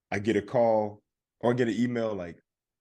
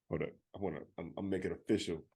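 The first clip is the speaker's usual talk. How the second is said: dismissive mumble; 6 semitones lower, 10 dB quieter, much faster than usual. Retold or imitated. Mimicking the dismissive worker.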